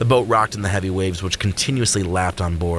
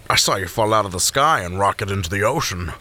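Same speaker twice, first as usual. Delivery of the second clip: usual speaking voice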